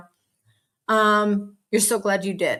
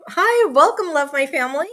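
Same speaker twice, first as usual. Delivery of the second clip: high bright greeting, much louder; excited welcome; 7 semitones higher, 4 dB louder than usual. Acted. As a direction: greet the newcomer with a burst of joy. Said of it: "Hi, Pampered with Diamonds sent me".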